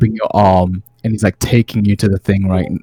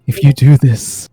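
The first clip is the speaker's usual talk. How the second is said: pleading mother; 4 semitones higher, 6 dB louder than usual. Acted. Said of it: "the familiar voice of your mother saying".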